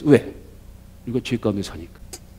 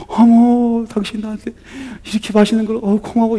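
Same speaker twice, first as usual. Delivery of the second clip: higher, a light mock of a thrilled wife; delighted wife; 8 semitones higher than usual. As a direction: imitate a wife gushing with thanks, lightly. Wife imagines husband's delighted thanks. (살짝 흉내 낸 감격한 아내 목소리)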